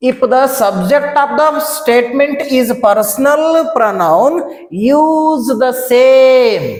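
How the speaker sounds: very slow, drawn-out dictation; rule read aloud. Shown as a demonstration. Teacher reading a grammar rule to students, slow dictation. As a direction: read it out slowly, stretching the words.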